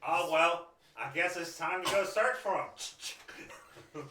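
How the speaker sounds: high, loud, mock-cheerful guard; high mock-eager guard. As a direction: ham up a chirpy guard.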